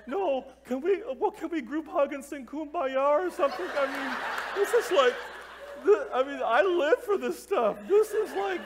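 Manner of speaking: high, loud hammed-up tearful pleading; weeping. Tearful youth leader wanting a group hug, weeping comically. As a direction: imitate blubbering on, too moved to finish. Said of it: "I'm, like, openly weeping".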